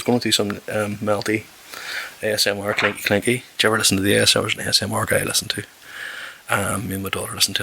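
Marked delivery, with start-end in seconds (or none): none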